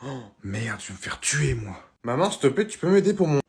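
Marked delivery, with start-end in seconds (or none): none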